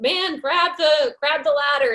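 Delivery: high mock shout, drawn out slowly; retold shout. Helicopter rescuer shouting down to the man on the roof, mock slow high shout. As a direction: call him up to the ladder, slow and high. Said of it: "a guy shouts out".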